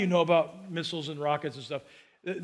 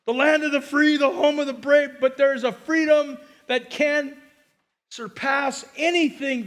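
high, loud patriotic preaching lift; 6 semitones higher, 4 dB louder than usual. Acted. Pastor rousing the congregation about freedom, high and loud. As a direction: lift it like a rallying cry.

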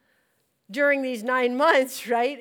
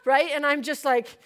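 ordinary delivery

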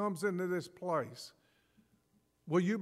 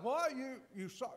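incredulous question; 6 semitones higher, 4 dB quieter than usual. Retold or imitated. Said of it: "And it's like".

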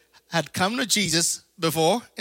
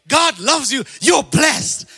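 higher, loud sing-song "God loves you"; a preacher mocking what the church wants to hear, shouted; mock cheering; 7 semitones higher, 7 dB louder, slower than usual. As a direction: belt it like a cheap crowd-pleaser. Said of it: "Did you wanna hear".

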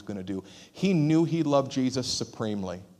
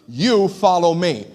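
slow, louder, stern command; stern rebuke; 3 semitones higher, 6 dB louder, slower than usual. Retold or imitated. Jesus rebuking Peter, slow and stern. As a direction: stress "You" firmly. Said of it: "He's rebuking him".

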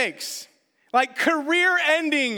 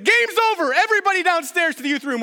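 loud, fast, high-pitched call to the group; calling out orders; 6 semitones higher, 6 dB louder, faster than usual. Retold or imitated. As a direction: shout the orders across the church, rushing. Calling leaders down to youth room.

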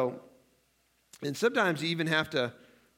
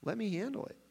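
higher, soft gentle call; tender invitation; 6 semitones higher, 8 dB quieter than usual. Retold or imitated. Jesus gently inviting the worried to come, soft. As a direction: coax softly, like calming a child. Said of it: "And he said".

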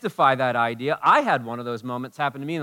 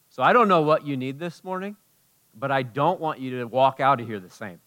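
ordinary delivery

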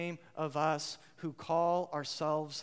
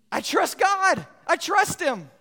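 mock confident claim; 12 semitones higher, 13 dB louder, slower than usual. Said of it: "It's easy to say".

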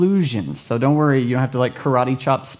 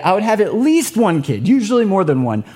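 usual speaking voice

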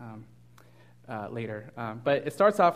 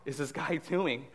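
freaked out. Retold or imitated. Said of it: "He's totally like".

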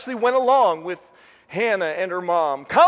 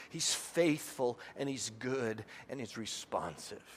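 hushed preaching; 8 semitones lower, 14 dB quieter than usual. Real, not acted. Preacher to congregation on God's faithfulness, hushed. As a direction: drop to a reverent hush. Sermon climax dropping to hush.

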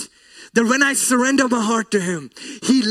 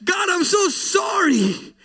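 impassioned, pleading retelling; retold tearful apology; 7 semitones higher than usual. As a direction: imitate a tearful apology to God. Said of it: "that day I cried and said".

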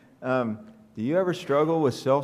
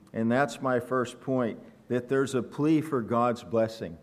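normal talking voice

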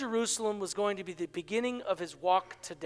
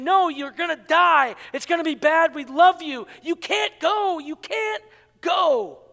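loud, high, desperate pleading; mock tearful begging, loud and high; pleading cry; 7 semitones higher, 6 dB louder than usual. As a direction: beg him not to leave, voice straining. Church begging Paul, voiced plea.

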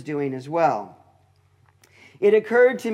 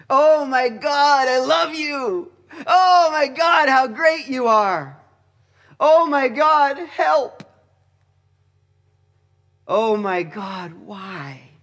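raised, louder cry of Oh my God, stretched slow; anguished prayer to God; 5 semitones higher, 4 dB louder, slower than usual. Shown as a demonstration. Preacher praying aloud to God in anguish, crying out. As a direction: plead with God, voice breaking, then sink to a hushed why. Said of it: "if you're talking to God".

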